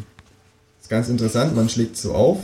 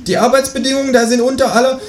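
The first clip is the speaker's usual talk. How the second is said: very high-pitched kangaroo voice; calling kangaroo; 3 dB louder than usual. A narrator's reading. Kangaroo complains after the departing neighbour, high voice.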